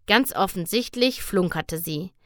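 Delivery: ordinary delivery, nothing marked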